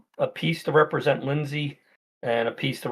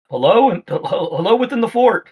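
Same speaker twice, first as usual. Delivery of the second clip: calling out; 9 semitones higher, 7 dB louder than usual. Pretend. Calling out at the gate.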